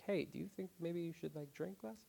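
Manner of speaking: high, soft timid asking; mom's gentle question. Retold or imitated. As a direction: ask him ever so carefully. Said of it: "she said".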